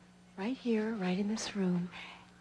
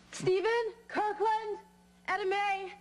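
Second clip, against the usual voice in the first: high, loud calls for family; calling out; 10 semitones higher, 5 dB louder, slower than usual. Acted. Mother looking for her boys at home, calling out. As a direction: call their names loudly through the house.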